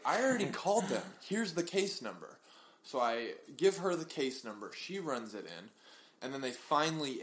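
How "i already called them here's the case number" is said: exasperated owner to the nurse, fed up. Retold or imitated. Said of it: "I was like".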